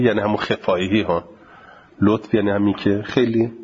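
ordinary delivery

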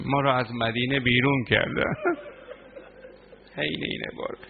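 real tears choking the words; crying. Real, not acted. Preacher weeping while telling mourners of Husayn's exile, sobbing. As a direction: voice breaking into sobs. Preacher breaks down mid-lament.

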